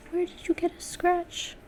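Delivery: high, small, soft voice; plaintive question, softly and high; quiet dismay. Real, not acted. Artist asks her tablet about the scratch, softly dismayed.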